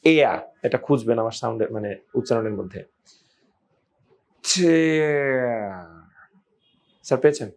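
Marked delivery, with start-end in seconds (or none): 4.4-5.9 "tear" stretched right out, falling; very slow demo. Shown as a demonstration. Teacher letting learners hear the hidden sound, very slowly. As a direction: draw out the glide in the middle of "tear". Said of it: "টিয়ার ধীরে বললে".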